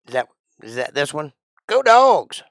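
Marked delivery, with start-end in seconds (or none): 1.7-2.4 much higher, much louder yell; full-throated Go Dogs! cheer; big cheer. Pretend. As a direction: belt it out like a stadium fan. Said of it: "You forgot that part".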